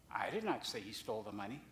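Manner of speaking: "not" stressed with a rise, said quietly. Shown as a demonstration. Preacher demonstrating the phrase to the congregation, stress on NOT. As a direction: put the weight on "not". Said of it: "say that phrase in a number of different ways".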